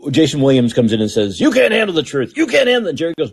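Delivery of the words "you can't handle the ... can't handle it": Jack Nicholson impression. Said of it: "I'm doing my, uh, Jack Nicholson impersonation".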